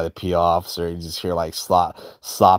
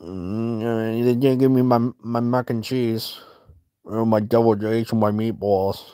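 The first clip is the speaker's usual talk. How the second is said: sulky whine. Retold or imitated. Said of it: "That's his fucking excuse".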